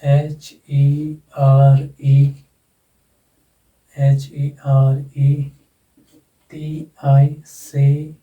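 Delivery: letters spelled one by one, very slowly; teacher spelling a word slowly. Shown as a demonstration.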